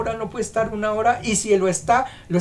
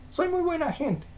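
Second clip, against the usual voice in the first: smug self-praise; 9 semitones higher, 6 dB quieter than usual. Retold or imitated. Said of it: "Muchos dicen eso".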